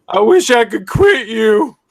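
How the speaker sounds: loud, high, drawn-out mock plea; mock lament. Host teasing the returning co-host, hammed-up lament. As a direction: wail it slowly with hammed-up longing.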